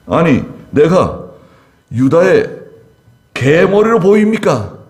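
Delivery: mock fury. Abner insulted by the king's accusation, building mock fury. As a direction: play Abner's wounded pride, building to indignation. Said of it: "매우 분노하여 말했다".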